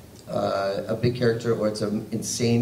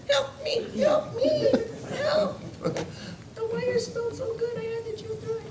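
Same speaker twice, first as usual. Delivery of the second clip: very high, squeaky little voice; playful high-pitched critter plea; tiny trapped critter; 5 dB quieter than usual. Pretend. Voicing trapped furry under the stage.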